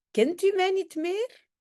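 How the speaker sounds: put-on high, lightly Spanish-accented Dutch. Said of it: "on va en fait imiter l'accent espagnol". (néerlandais aigu au léger accent espagnol)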